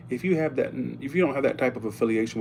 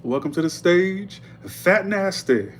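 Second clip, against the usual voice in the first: high, slow, drawn-out MC call; club announcer call; 7 semitones higher, 4 dB louder, slower than usual. Retold or imitated. Sister and mama mocking the club announcer. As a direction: draw the name out big for the crowd. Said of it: "every night they'd go".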